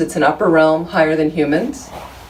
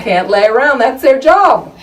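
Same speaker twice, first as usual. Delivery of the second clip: louder and higher, pushing the punchline; joke punchline; 9 semitones higher, 7 dB louder than usual. Retold or imitated. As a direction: land the comedian's gag with a hammed-up lift. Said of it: "George Carlin's joke".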